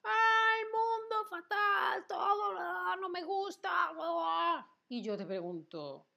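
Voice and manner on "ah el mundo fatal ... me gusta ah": high, loud whiny moaning about everything; mock complaining. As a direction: wail in mock despair over the world. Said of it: "¿Te quejas?".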